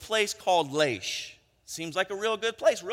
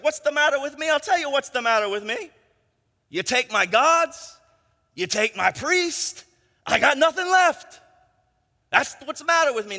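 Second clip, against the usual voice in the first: aggrieved yelling, pitched up and loud; Micah; 8 semitones higher, 6 dB louder than usual. Acted. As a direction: protest loudly, building to an outburst. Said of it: "Micah in verse twenty-four is like".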